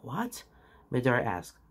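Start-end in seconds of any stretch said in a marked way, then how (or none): 0.0-0.4 quiet, stunned mumble; soft mumble. A narrator's reading. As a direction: barely mumble it in shock. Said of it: "but softer this time. It was more of a mumble".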